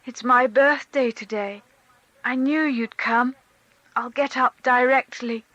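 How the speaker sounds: put-on a drowsy boy sure she would come; sleepy little boy. A narrator's reading. Boy tells his mother it's his birthday, sleepy and happy. Child Seryozha's voice.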